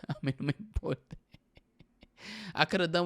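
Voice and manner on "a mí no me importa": laughing. Real, not acted. Repeats laughing.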